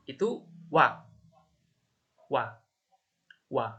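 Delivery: oi said as "wa", one open syllable; "Wa." clear and short, like warteg. Shown as a demonstration. French teacher showing oi read as wa. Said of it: "Cara pengucapannya yang".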